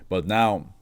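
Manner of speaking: ordinary delivery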